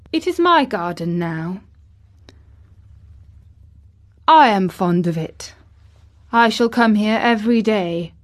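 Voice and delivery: put-on boy making a firm announcement; Colin. A narrator's reading. Colin claims the garden as his own, imperious. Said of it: "announced Colin".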